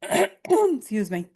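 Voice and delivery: throat-clearing, rough voice; croaky apology after clearing throat; hoarse. Real, not acted. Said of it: "clears throat".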